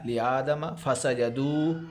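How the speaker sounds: فَسَجَدُوا with the final و drawn out, slowly; long madd on do. Shown as a demonstration. Teacher demonstrating the madd on فَسَجَدُوا, slow. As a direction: hold the دُو in فَسَجَدُوا for three alifs. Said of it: "دو کے اوپر پھر مد ہے".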